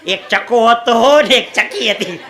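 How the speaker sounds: slow storybook opening; storytelling voice. Shown as a demonstration. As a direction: start it like a bedtime tale. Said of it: "વાર્તા કહું ચકાચકીની". (ધીમી વાર્તા કહેવાની શૈલી)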